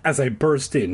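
plain reading voice

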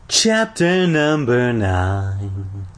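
very slow, drawn-out announcer voice; chapter announcement. A narrator's reading. Reader announcing the next chapter, drawn-out announcement. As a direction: stretch each word out grandly.

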